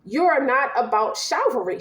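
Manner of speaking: ordinary delivery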